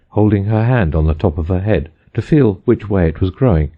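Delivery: ordinary delivery, nothing marked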